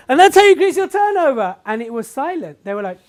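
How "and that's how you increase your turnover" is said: high, loud triumphant finish; triumphant climax. Retold or imitated. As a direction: sell the big reveal loudly. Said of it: "I was like".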